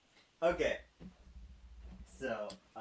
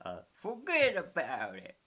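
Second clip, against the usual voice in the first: put-on New York accent, higher; 6 semitones higher than usual. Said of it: "I'm trying to do a New York accent".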